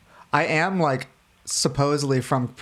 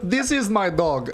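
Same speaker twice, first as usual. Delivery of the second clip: put-on slow, laughing Russian accent; heavy Russian accent, slowly; crazy Russian hacker; 6 semitones higher, slower than usual. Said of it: "I'll just do a crazy Russian hacker".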